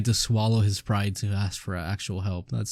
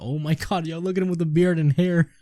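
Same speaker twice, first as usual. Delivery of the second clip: excited; 8 semitones higher than usual. Real, not acted. Reactor shocked at priest's look.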